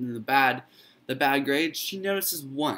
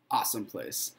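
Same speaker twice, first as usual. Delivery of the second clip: ordinary delivery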